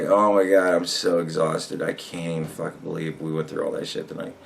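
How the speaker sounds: fading to a soft, drained mumble. Retold or imitated. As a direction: sound wiped out after a long night. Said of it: "just like".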